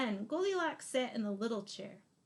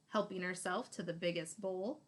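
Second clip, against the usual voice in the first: ordinary delivery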